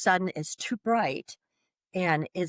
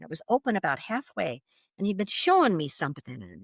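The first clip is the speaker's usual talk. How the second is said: ordinary delivery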